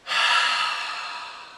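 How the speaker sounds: long audible exhale; deep breath out. Shown as a demonstration. Showing viewers how to calm down, a long exhale. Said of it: "take a deep breath".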